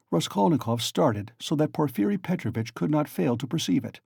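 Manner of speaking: plain reading voice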